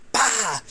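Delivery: imitated chop impact. Wrestler showing how a chop lands, imitated smack noise. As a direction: punch out the sound of a chop.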